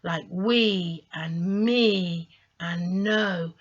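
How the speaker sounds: we, me and no slow, vowels stretched out; long vowel names. Shown as a demonstration. Teacher to learners, modelling long vowel sounds. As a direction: hold each long vowel in we, me and no. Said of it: "we hear the name of the vowel".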